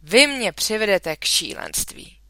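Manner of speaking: a touch of a father losing it; mild shout. A narrator's reading. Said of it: "křičel tatínek". (mírný křik)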